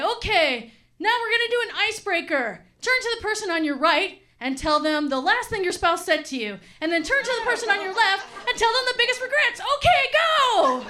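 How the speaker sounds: high, bubbly, building to a shrill go; perky counselor. Retold or imitated. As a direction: run the icebreaker like a peppy counselor. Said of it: "And then she'll say".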